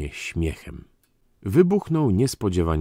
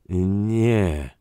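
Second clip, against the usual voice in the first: drawn-out, hesitant denial; unsure voice; 3 semitones higher, 4 dB louder than usual. A narrator's reading. Dyzma denies thinking the count mad, uncertainly. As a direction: deny it without conviction. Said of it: "rzekł niepewnym głosem".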